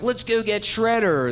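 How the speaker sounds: rally the team, half joking; mock heroic turtles. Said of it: "the Turtles are like".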